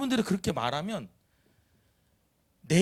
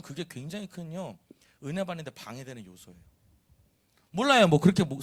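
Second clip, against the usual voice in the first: usual speaking voice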